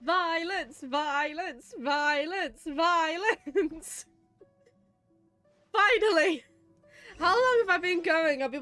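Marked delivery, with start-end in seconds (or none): none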